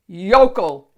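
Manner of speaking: loud contemptuous snap; loud scornful snap; snapped insult. Acted. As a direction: spit the word at the peasant. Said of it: "Shrek snapped".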